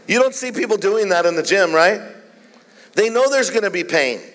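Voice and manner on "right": ordinary talk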